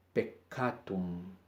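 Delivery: Peccatum said slowly and softly, each C hard; slow Latin example. Shown as a demonstration. Teacher reading an example word, slow and soft. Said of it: "como en la regla".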